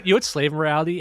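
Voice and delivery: normal talking voice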